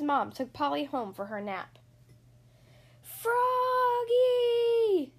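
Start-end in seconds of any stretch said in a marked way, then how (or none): 3.0-5.1 high, drawn-out sung call; yelled. A narrator's reading. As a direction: call it across the field. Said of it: "yelled the coach".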